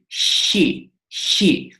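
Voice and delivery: 'she' with a breath of soft loch-like 'ch'; soft Greek chi sound. Shown as a demonstration. Greek teacher repeats the correct letter chi, soft loch-like sound. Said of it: "that sound but a bit softer".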